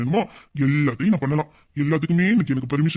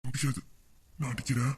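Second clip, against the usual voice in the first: much softer, low murmured refusal; hushed refusal; 5 semitones lower, 13 dB quieter than usual. Acted. Husband softly refuses to let his wife go, low and hushed. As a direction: hush it, teasing and close.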